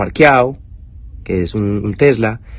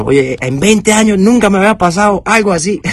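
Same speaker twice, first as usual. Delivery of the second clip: put-on high, louder Cuban accent, amused disbelief; 10 semitones higher, 3 dB louder than usual. The Cuban officer laughs that in twenty years this never happened, in Cuban accent. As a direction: tell it like a Cuban cop who's seen it all. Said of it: "porque él es cubano".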